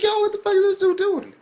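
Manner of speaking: very high, loud incredulous outburst; acted disbelief. Reviewer watching a dude dodge, exaggerated high-pitched disbelief. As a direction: squeal it in exaggerated bafflement. Acts out reaction to slow dodging.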